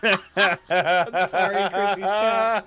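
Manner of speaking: loud, high, drawn-out laughing; laughing apology. Real, not acted. Host apologizes to the cat, laughing hard. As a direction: squeal the apology through real laughter.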